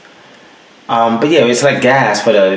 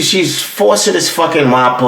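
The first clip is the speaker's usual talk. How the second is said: usual speaking voice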